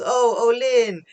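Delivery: high, loud acted exclamation; excited greeting. Tama calling to Olin, excited and high.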